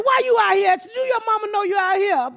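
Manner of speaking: high scolding yell; retold shout. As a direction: bark at the kid, half laughing. Scolding child in street.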